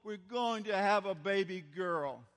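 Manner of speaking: loud, high, slow pleading call; pleading yell. Retold or imitated. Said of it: "she practically yells".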